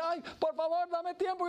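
high, whiny plea for time; small debtor begging for mercy; pleading debtor. Acted. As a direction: beg and wheedle. Second debtor begs for time.

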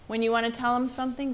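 ordinary talk, nothing marked

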